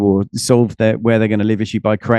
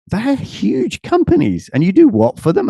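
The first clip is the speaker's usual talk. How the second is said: amazed; 10 semitones higher than usual. Retold or imitated. Reacting to big client names.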